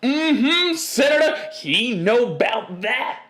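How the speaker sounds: high, loud mocking audience voice; audience heckler. Audience member outing the senator, high and loud. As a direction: squeal it like a gossiping crowd member. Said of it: "people from the other side of the room be like".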